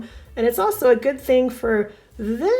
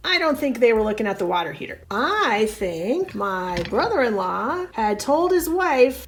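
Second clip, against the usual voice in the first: ordinary talk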